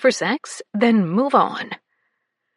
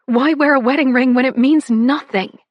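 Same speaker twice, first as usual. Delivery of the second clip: angry; 3 semitones higher, 7 dB louder than usual. Acted. Confronting Vito angrily.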